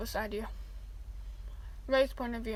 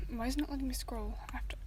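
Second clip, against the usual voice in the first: real whispered frustration; fast whispered aside at a broken scroll; frustrated whisper; 7 dB quieter, faster than usual. Real, not acted. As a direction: mutter at the screen in a whisper. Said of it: "frustrated aside while reading".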